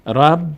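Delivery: "رب" as one closed syllable, ending on vowelless b; joined to silent letter. Shown as a demonstration. Teacher shows first reading of tashdid, syllable closed on b. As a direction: join r straight onto b in "رب". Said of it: "ر رو میچسبانیم به ب که ساکن".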